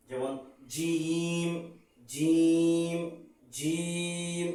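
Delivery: জিম said slowly, like the Bangla word ডিম; drawn-out জিম with the tongue set as for ডিম. Shown as a demonstration. Teacher giving beginners an easy trick for জিম, slow demo. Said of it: "ডিমের মতোই জিমটা একটু উচ্চারণ করি".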